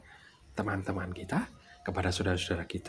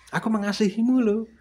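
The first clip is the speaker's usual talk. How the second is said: professed love; 10 semitones higher, 4 dB louder than usual. Acted. Acting out words-only love declaration.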